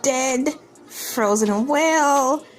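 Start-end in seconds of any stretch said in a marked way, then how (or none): none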